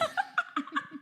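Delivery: helpless high laughing fit; high-pitched laugh. Real, not acted. Co-host catching a friend's fib, high laugh. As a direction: burst into squealing laughter.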